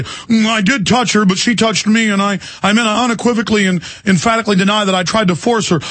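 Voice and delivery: high, put-on hedging voice; mock politician's excuse. Accused politician explaining away his denial, mock excuse. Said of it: "that's what he later could say".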